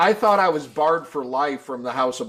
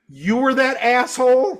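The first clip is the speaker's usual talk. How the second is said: high, louder disbelieving question; mock incredulous question; 9 semitones higher, 4 dB louder than usual. Fan recognizing the teller from that show, mock incredulous. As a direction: retell a stranger's amazed recognition. Retold reaction of an online fan.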